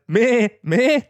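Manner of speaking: high, loud mock bleat; sharp rising goat call; bleating goat cry.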